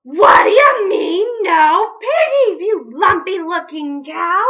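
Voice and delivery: high, loud, slow furious scream; screaming witch. Acted. Witch Grinch screaming at the cow. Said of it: "screamed Grinch".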